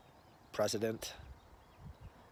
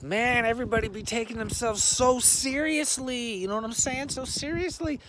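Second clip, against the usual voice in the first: high dude voice; 9 semitones higher than usual. Acted. Put-on high dude voice, argument.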